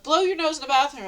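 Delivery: imitating a man yelling across the table; retold yell. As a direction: fire it off fast like the angry guy.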